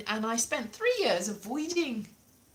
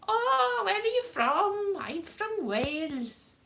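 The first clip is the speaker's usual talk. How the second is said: high, singsong fake Welsh accent; cod Welsh; 9 semitones higher, slower than usual. Comic acting out television Welsh chat, high cod accent. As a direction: swoop from high in mock Welsh. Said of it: "Cod Welsh".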